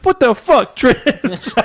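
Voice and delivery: very high mock outcry; shocked girl. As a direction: imitate an outraged girl confronting someone. Girl's shocked outburst, retold.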